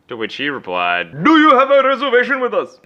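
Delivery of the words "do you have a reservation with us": high, loud Indian accent imitation. Clerk asking the customer, in a high Indian accent. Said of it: "That is exactly how he said it".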